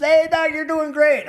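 high, loud mock coach cheer; coach calling out. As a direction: imitate a coach shouting encouragement to a runner. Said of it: "and coach says".

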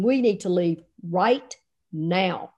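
slow, low, deliberate insistence; insistent. Retold or imitated. Wife to coach in labor, slowly insistent. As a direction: spell it out slowly and firmly.

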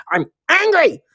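demo anger. Shown as a demonstration. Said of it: "Say it".

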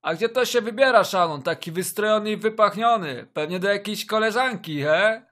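loud, high teasing dad; teasing father voice. Acted. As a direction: tease him like a nosy dad.

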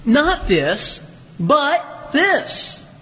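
slow, weighty contrast on "this"; emphatic contrast. Shown as a demonstration. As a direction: set "Not this" against "but this" with a pause. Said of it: "It is an emphatic adversative".

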